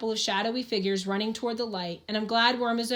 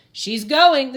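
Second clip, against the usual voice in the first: loud, higher warning cry; alarmed cry; 4 semitones higher, 11 dB louder than usual. Acted. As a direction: yell it out as the mine gives way. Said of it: "the sheriff cries".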